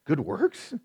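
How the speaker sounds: "Good works?" asked with a rising, mock-worried lift on "works"; mock alarmed question. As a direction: echo "Good works?" back as if the phrase were dangerous. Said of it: "that sounds dangerous, right?".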